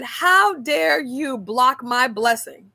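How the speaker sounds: slow, deliberate how dare you; indignant scolding. Retold or imitated. Coworker scolding her for refusing the gift, slow and indignant. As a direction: spell out every word as a reprimand. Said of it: "She said".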